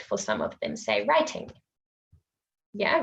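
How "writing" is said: "writing" with a crisp clear t; British careful t. Shown as a demonstration. Teacher contrasting British writing. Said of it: "A British speaker might".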